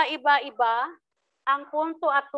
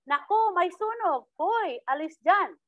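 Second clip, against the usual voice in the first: urgent cry of alarm, higher and slower; alarmed exclamation; 6 semitones higher than usual. Shown as a demonstration. Teacher demonstrating an alarmed fire warning. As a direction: sound the alarm about a fire. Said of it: "paghahayag na matinding damdamin".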